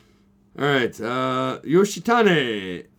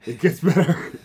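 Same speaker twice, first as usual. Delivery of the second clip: laughing; 2 semitones higher than usual. Real, not acted. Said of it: "repeats with a laugh".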